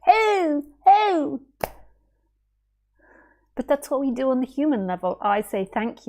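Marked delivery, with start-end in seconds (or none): 0.1-1.4 high, bright sing-song hey; playful hey. Real, not acted. Teacher greeting listeners in a playful high voice. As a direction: call out playfully.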